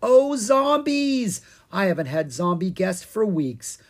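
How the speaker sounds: delighted witch. A narrator's reading.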